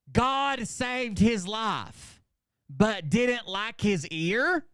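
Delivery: high, slow, declaimed read-out in separate chunks; mock haiku recital. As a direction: declaim each short phrase slowly with a pause after it.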